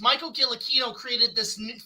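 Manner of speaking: ordinary delivery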